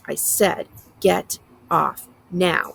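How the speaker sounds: slow, rumbling warning; a menacing slow rumble; low rumble. A narrator's reading. Driver repeating his order, slow low rumble. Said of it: "he rumbles".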